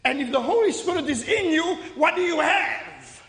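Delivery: ordinary delivery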